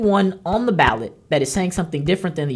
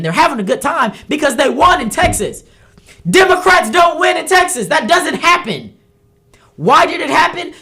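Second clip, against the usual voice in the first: higher and louder, fed up and venting; exasperated rant; 8 semitones higher, 5 dB louder than usual. Real, not acted. Host venting to listeners about losing Texas, angry and loud. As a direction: let the anger boil over at rising Democrats. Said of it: "I'm mad".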